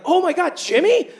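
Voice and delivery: high, excited gasp of recognition; surprised fan. Retold or imitated. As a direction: squeal it like a starstruck fan. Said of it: "they're like".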